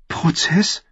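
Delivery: breathless scared whisper; frightened whisper. Acted. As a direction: whisper it in sudden fear. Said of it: "unverhohlene Angst in der Stimme". (Angst, geflüstert)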